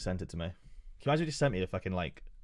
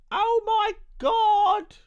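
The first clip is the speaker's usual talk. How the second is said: very high, loud, slow falsetto scream; overexcited squeal; 7 dB louder, much slower than usual. Acted. Streamer squealing at the roadmap news, very high slow falsetto. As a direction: shriek it out in mock ecstasy.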